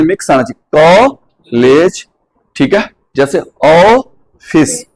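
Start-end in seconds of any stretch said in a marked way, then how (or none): 3.2-4.8 ऑफिस very slow, ऑ gliding from आ to ओ; drawn-out vowel. Shown as a demonstration. Teacher modeling ऑफिस for students, slowly stretched. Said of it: "आ के साथ ओ".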